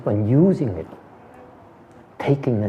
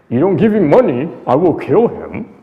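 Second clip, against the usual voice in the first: put-on a louder, higher hooded robber's threat in a British accent; 5 semitones higher, 7 dB louder than usual. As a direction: threaten the boy's guardian in crisp British English. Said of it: "they speak in perfect British accent".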